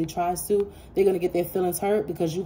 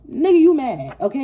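sharp, much higher and louder taunt; loud mocking jab; 8 semitones higher, 8 dB louder than usual. Real, not acted. Reader mocking the angry rejected person, loud and high. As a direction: laugh it off loudly at them.

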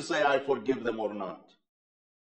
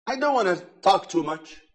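normal talking voice

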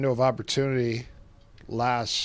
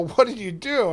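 high, louder astonished asking; amazed question; 9 semitones higher, 6 dB louder than usual. Retold or imitated. Friends amazed the sleeper woke, high astonished question. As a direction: sound stunned that it worked.

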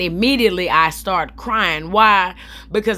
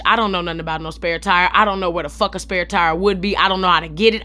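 ordinary talk, nothing marked

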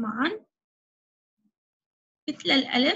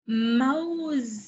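drawn-out word; much slower than usual. Shown as a demonstration. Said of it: "ما هذا الشكل".

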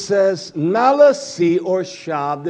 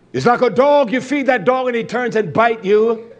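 raised preaching voice; 6 semitones higher, 5 dB louder than usual. Real, not acted. Preacher raises voice on dog image.

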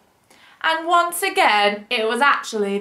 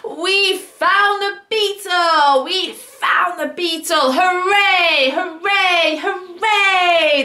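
high, louder slow cheering; cheering shout; 6 semitones higher, 4 dB louder, slower than usual. Acted. Everyone finds the beetle and cheers. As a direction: celebrate loudly together. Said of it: "they shouted".

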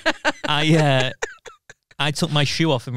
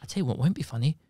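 angry Kiwi mum; 7 dB quieter than usual. Retold or imitated. Mum tearing into the teacher, in a quiet, menacing Kiwi accent. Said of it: "when she gets angry, she turns into that, like ... Kiwi".

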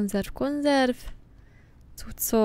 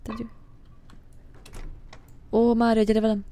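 ordinary delivery

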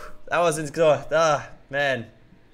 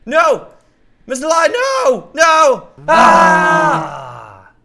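loud high desperate shouts; panicked scream; 11 semitones higher, 11 dB louder, much slower than usual. Acted. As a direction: scream as the cow falls. Cow falling, panic.

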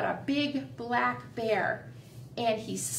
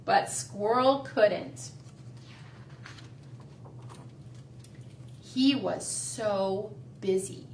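slow storybook reading; reading to children; 5 dB quieter than usual. A narrator's reading. Teacher reading The Busy Little Squirrel to the class, slow. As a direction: read it slowly to little kids.

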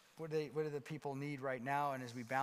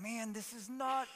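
panicked inner thought; 7 semitones higher than usual. Retold or imitated. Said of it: "I remember thinking, I'm like".

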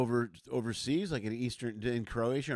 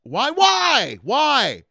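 loud, high-pitched protest; indignant outburst; 7 semitones higher, 9 dB louder than usual. Real, not acted. Defending an old man's vodka and pickles, indignant shouting. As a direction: raise the roof in protest. Mock outrage on dad's behalf.

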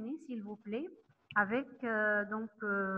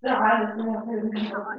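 loud call for attention; 11 dB louder than usual. Real, not acted. Teacher calls class to attention.

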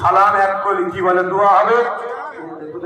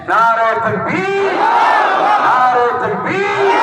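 slow drawn-out rally call; slogan call; 11 semitones higher, much slower than usual. Real, not acted. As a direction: call the crowd slogan slowly. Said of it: "একটু slogan দিন".

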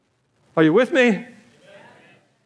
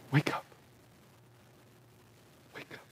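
near-whispered appeal; barely voiced, with long pauses; hushed plea; 21 dB quieter, faster than usual. Real, not acted.

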